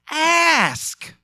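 insistent, high-pitched ASK; emphatic. Shown as a demonstration. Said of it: "it's emphatic".

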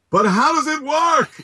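put-on high, slow baffled old dad voice; mock confused dad; imitating dad. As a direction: pitch way up like a puzzled father. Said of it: "he's like".

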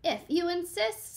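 lightly voiced lobster accepting. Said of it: "said the lobster".

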